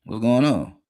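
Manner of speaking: high, loud call to the chat; loud high greeting shout. Real, not acted. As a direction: hail the viewers. Greets chat after a pause.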